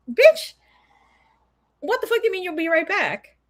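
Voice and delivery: high-pitched mock outrage; indignant retort. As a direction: snap it high, in disbelief at the excuse. Outraged retort to retold excuse.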